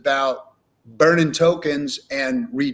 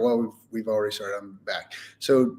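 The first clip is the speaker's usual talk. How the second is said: ordinary delivery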